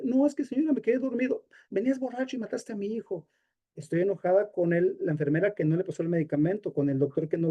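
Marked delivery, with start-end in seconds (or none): 0.0-1.5 higher, excusing himself; pleading driver. Retold or imitated. Guilty driver excusing himself to the mother, higher pleading voice. 1.7-3.3 higher, softer, bitter blame; bitter accusation. Retold or imitated. Grieving mother to the drunk driver, higher and softer bitter blame.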